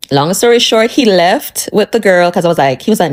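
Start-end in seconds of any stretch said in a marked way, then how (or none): none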